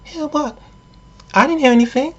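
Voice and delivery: very high mock-female voice; high put-on girlfriend voice; Janet. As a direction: play innocent in a high falsetto. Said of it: "Janet goes".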